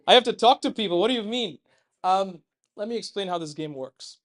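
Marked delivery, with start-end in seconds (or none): 0.0-1.6 loud, higher mock protest; mock reluctant audience. Teasing volunteers who must talk to people, mock reluctance. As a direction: imitate a crowd balking in loud dismay. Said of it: "now they're like".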